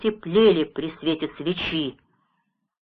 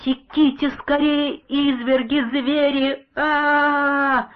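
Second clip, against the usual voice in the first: high terrified scream; screaming in a nightmare; 9 semitones higher, slower than usual. A narrator's reading. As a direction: cry out in horror from a nightmare. Nightmare cry continues.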